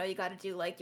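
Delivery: normal talking voice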